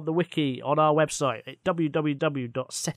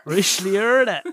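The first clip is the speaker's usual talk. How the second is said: higher, louder mock Cornish; hammed-up terrible Cornish accent; 5 semitones higher, 6 dB louder than usual. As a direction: say it like a broad Cornish local. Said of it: "our terrible accents this week".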